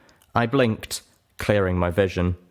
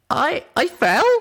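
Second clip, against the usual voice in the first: high, halting "I, I fell?"; stunned disbelief; 6 dB louder, slower than usual. Acted. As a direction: stammer in shock. Pip shocked to learn she fell.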